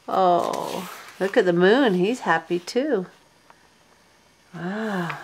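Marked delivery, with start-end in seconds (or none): none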